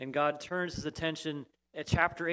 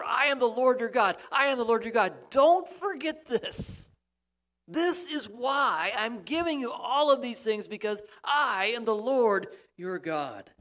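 God's insistent refrain; 6 semitones higher, 5 dB louder than usual. Acted. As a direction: hammer the refrain home as God. Preacher repeating God's refrain.

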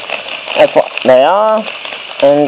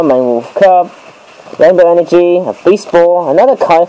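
ordinary delivery

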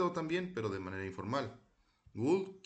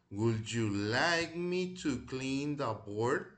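slow model reading; slower than usual. Shown as a demonstration. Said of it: "vamos a repetirlas".

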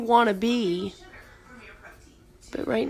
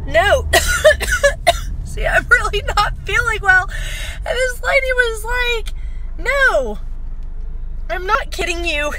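high voice breaking into tears; tearful; 13 semitones higher, 4 dB louder than usual. Real, not acted. Sick woman retelling a stranger's kindness, tearful. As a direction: choke up while telling the story, pitch jumping up.

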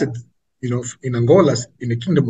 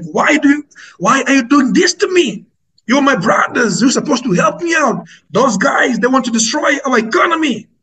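furious protest; 10 semitones higher than usual. Retold or imitated. Ramaphosa protesting to fellow African presidents, high indignant outburst. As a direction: voice the wounded president crying out. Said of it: "he was very furious".

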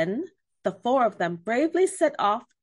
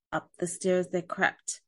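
usual speaking voice

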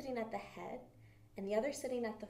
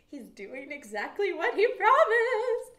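much louder, higher, slower burst; excited; 10 semitones higher, 13 dB louder, slower than usual. Real, not acted. Teacher tells the children with loud excitement. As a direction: proclaim it with joy.

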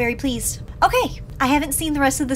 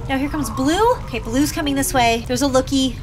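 ordinary delivery

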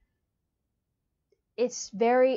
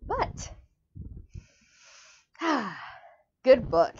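usual speaking voice

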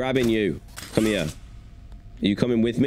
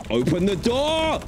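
frustrated yell; 13 semitones higher than usual. Real, not acted. Stuck at the power room door.

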